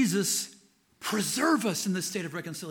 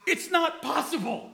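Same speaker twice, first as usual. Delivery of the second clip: high, laughing declaration; joyful certainty breaking into a laugh; overjoyed preacher laughing; worked-up laugh; 9 semitones higher than usual. Real, not acted. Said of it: "I'm getting worked up".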